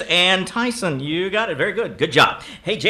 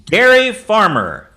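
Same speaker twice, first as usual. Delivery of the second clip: loud, slow, higher announcement; big drawn-out game-host call; announcing the suggestion; 5 semitones higher, 8 dB louder, slower than usual. Real, not acted. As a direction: proclaim it to the room. Host announces the suggestion, first take.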